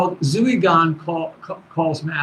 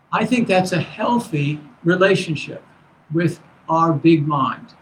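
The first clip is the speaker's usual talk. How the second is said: normal talking voice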